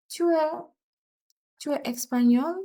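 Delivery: rising question intonation on espagnol; question tune. Shown as a demonstration. Teacher modelling a French question with a rising end. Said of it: "Interrogative sentence".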